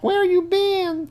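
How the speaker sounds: high falsetto, slow and whiny; mock girl voice. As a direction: drag it out like a pouting girl. Kayla's message voiced.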